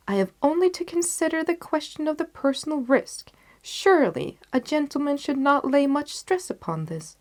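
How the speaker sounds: gallant, persuasive detective; Holmes persuading. Acted. Holmes voiced in story.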